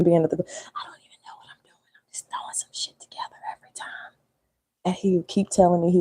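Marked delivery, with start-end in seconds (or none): 0.0-3.9 whispered confession, breathy and hushed; secret whisper. Real, not acted. Telling viewers about her cooking, in a hushed whisper. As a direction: lean in and whisper it so hubby won't hear.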